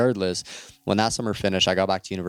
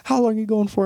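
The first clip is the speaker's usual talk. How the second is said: shocked mom. Retold or imitated. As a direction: play the stunned mother squeaking in disbelief. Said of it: "She's like".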